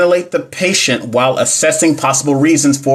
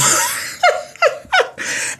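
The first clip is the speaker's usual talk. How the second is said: breathy laugh; 5 dB quieter than usual. Real, not acted. Laughs at silly option.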